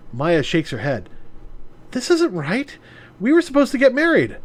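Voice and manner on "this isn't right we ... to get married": put-on upset young woman. Voices Maya upset.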